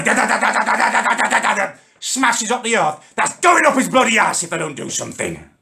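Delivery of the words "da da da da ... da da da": rapid, higher da-da-da mimicking a pneumatic drill; imitated drilling machine. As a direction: rattle it out like a jackhammer. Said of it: "that bloody machine they've been using".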